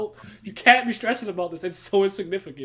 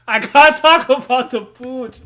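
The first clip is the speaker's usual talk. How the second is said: much louder and higher, stretched out slowly; loud slow declaration; 6 semitones higher, 14 dB louder, slower than usual. Real, not acted. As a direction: announce "talk" slowly and loud like a big reveal. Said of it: "this shit is so fucking funny to me".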